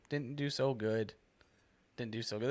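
usual speaking voice

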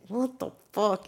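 a slow baffled what-the-hell to a friend; stunned disbelief. Retold or imitated. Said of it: "I look back at Julian and I'm like".

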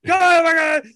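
high, loud shout at the dog. Retold or imitated. Owner shouting at the dog behind the door. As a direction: yell to chase the dog off.

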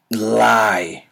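ordinary talk, nothing marked

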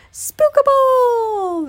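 high, loud, long-drawn wail; terrified wail. A narrator's reading. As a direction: stretch the word into an alarmed howl. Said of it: "they wailed".

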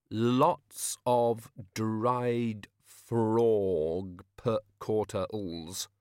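dragged out word by word; very slow one-finger dictation; slow typing. A narrator's reading. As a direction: spell it as if pecking at keys. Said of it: "typed with one finger as slowly".

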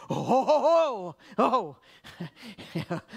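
loud, high wailing "oh"; mock crying outburst. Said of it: "I've been crying now for".

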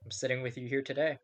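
upbeat show-opening announcer read; podcast host intro. Acted. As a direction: welcome the listeners like a radio host.

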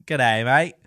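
playful Aussie mimicry; Australian accent. Echoing a friend's joke, mock Australian accent. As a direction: greet in a put-on Australian accent. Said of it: "obviously the accent and everything".

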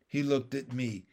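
plain reading voice

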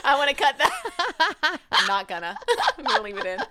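helpless giggles breaking into the words; real laughter. Real, not acted. Cracking up after the accent attempt.

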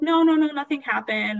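high-pitched, insistent retold denial; hasty denial. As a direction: brush off a worried sister, pitched high. Retold denial to worried sister.

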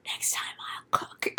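hushed conspiratorial whisper. Retold or imitated. Mom whispering to her youngest son, secret plan. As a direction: breathe it out secretly. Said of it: "she goes".

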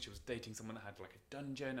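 ordinary talk, nothing marked

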